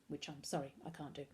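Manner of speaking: usual speaking voice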